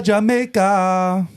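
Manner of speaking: high, drawn-out Jamaican lilt on Jamaica; Jamaican accent. Retold or imitated. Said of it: "her Jamaican accent".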